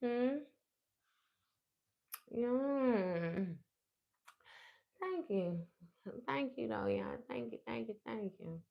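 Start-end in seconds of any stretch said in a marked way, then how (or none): none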